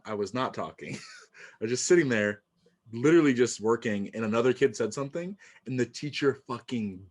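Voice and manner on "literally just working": ordinary talk, nothing marked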